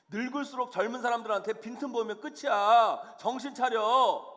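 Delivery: loud mock telling-off; condemning scolding. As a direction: scold like a fault-finding God, pressing harder to the end. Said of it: "그렇게 정죄하시는 분이 아니라는".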